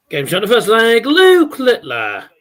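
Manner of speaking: loud, high caller's announcement; darts score caller. Retold or imitated. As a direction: call it like a darts MC. Calling the checkout like a referee.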